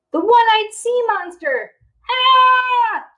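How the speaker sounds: high loud scared scream; screaming in fright. Acted. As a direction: shriek as if the sailor is there. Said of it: "everyone screamed".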